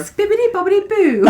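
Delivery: high, loud, laughing spell-casting voice; Cinderella's fairy godmother. Pretend. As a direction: chant it like a giddy magic spell. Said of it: "Cinderella fairy godmother".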